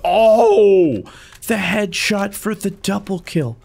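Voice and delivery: excited. Real, not acted. Headshot excitement.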